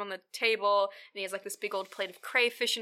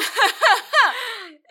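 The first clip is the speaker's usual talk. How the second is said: high loud burst of laughter; 12 semitones higher, 9 dB louder than usual. Real, not acted. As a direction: crack up at the joke. Laughing at the flirty line.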